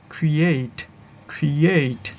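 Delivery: ক্রিয়েট with an ei glide; correct pronunciation. Shown as a demonstration. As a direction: say ক্রিয়েট as "kri-eit". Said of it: "সঠিক উচ্চারণ হবে".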